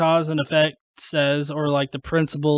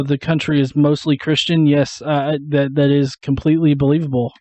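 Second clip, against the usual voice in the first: ordinary talk, nothing marked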